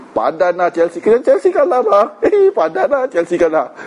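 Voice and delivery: playful jeer, pitch leaping up; mock taunting. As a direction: tease like a gloating rival's fan. Acts out a football taunt at husband.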